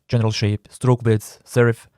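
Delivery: usual speaking voice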